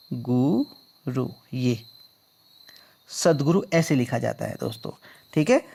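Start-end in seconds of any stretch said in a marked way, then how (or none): 0.1-1.3 गुरु drawn out very slowly and softly; slow dictation. Shown as a demonstration. Teacher slowly dictates गुरु while writing. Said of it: "कैसे लिखते हैं वो भी मैं बताता हूँ".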